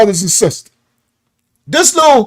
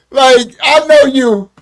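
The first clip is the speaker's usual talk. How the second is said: ordinary talk, nothing marked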